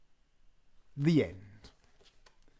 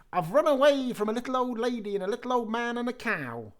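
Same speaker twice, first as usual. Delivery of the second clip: high taunting cheese man; 8 semitones higher than usual. Acted. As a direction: taunt them in a high sing-song.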